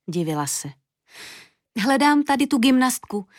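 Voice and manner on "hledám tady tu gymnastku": put-on high, cheerful child's call; ringing exclamation. A narrator's reading. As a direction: call it out brightly, pitched up. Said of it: "zahlaholila Julinka, až se to rozlehlo". (zvučné, vysoké zahlaholení)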